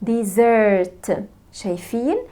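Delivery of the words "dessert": "dessert" with a drawn-out, stressed SERT; long second syllable. Shown as a demonstration. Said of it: "السيلابل التاني زرت طويل". (المقطع التاني "زرت" طويل وعليه النبر)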